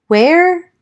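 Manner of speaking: rough hoarse croak. Acted. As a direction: sore throat rasp. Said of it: "I said hoarsely".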